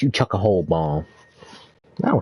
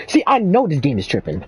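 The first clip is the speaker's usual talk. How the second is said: exasperated, voice pitched up; frustrated high rant; 4 semitones higher than usual. Real, not acted. Frustrated at game controls.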